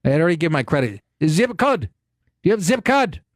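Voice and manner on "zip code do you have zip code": lightly imitated Nigerian accent, higher; a hint of Nigerian accent, repeating zip code; imitating the Nigerian gas station attendant's accent; mock Nigerian accent. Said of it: "heavy Nigerian accent".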